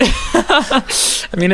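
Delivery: laughing. Real, not acted. Cohost laughs at the joke.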